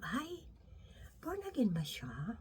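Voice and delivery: hushed whispered aside, almost no voice; gossipy whisper. Pretend. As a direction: whisper it like church gossip behind someone's back. Imagined gossip doubting someone's faith.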